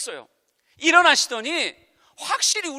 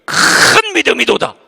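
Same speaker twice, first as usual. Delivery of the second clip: solemn praise; 2 semitones lower, 11 dB louder than usual. Pretend. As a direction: pronounce praise like Jesus. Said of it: "칭찬해 줄 거예요". (성경 말투로 엄숙하게 칭찬)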